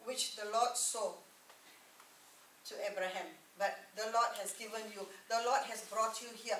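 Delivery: ordinary delivery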